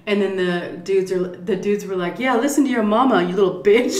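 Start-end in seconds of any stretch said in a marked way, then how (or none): 2.2-3.9 higher, louder jeer broken by laughter; mocking jeer. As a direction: taunt him while laughing. Thugs mocking Aaron.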